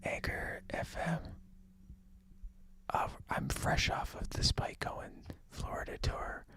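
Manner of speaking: whispered, with a hummed beat; whispering. Pretend. Whisper segment.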